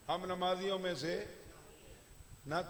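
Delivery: ordinary talk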